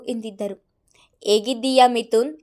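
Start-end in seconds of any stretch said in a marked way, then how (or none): 1.2-2.4 a touch of gruff menace, louder; lightly roughened caller's voice, mocking. A narrator's reading. Old enemy on the phone greeting Mithun, lightly gruff. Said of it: "ಗಡಸು ಧ್ವನಿಯನ್ನು ಕೇಳಿ".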